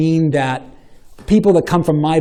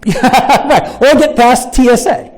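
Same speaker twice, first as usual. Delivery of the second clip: laughing; 7 semitones higher, 5 dB louder, slower than usual. Real, not acted. Repeating a joke while laughing.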